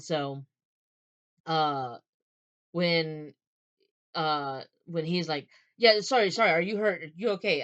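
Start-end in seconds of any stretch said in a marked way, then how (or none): 5.8-7.6 hurried, louder burst of apology; apologetic checking. Acted. Man checking the woman he knocked into, apologetic. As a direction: blurt out apologies and concern. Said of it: "apologizing, he's checking to make sure".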